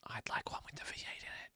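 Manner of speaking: hushed, unvoiced aside; confidential whisper. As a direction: whisper it like a secret wish. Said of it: "just quietly".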